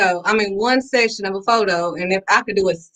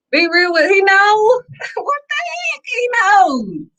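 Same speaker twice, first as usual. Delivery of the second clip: laughing exclaim; 11 semitones higher than usual. Real, not acted. Telling viewers God already knows, with a high laughing outburst. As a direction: laugh it out in disbelief.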